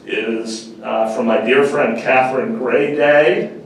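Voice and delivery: normal talking voice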